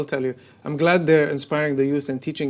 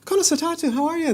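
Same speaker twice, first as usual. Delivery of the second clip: higher, hammed-up Irish greeting; mock Irish accent; 4 dB louder than usual. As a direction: greet them like a stage Irishman, for laughs. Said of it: "come out of school with an Irish accent".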